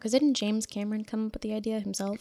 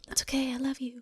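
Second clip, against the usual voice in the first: breathy mock whisper; 4 semitones higher than usual.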